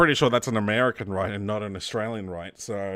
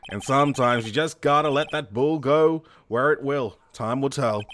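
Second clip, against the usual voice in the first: put-on American accent; 2 semitones higher than usual. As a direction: play a cowboy partner with an American accent. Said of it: "how's my American accent".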